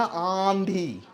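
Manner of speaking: आंधी slow with nasalized first vowel; आंधी, long nasal आ. Shown as a demonstration. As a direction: stretch the start of आंधी through the nose. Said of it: "हमने अनुनासिक लगा दिया".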